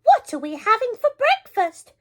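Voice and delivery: put-on high, chirpy old lady asking; high granny voice. A narrator's reading. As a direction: ask brightly like a granny. Granny at the door.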